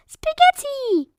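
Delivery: high slow cheer. A narrator's reading. Peppa cheering for spaghetti, high. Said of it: "cheered Peppa".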